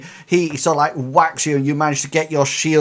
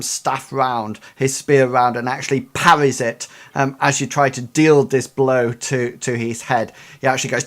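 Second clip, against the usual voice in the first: ordinary delivery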